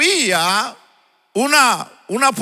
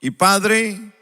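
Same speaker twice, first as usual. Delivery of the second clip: prayer; 6 semitones lower than usual. Acted. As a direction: lead the congregation in prayer. Opening prayer before the sermon.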